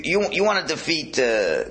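usual speaking voice

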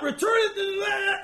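high, slow, shouted disbelief; shocked outrage. Retold or imitated. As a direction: shriek back in outrage at the demand. Spouse's indignant reply.

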